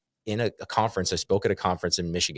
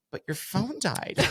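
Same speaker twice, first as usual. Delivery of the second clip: higher, slow, softer disbelief; imitating a stunned clerk; 11 semitones higher, 4 dB quieter, slower than usual. Guest mimics the clerk stuck on the dead phone, slow mock disbelief. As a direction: drag it out like she can't get past it. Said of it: "She's like".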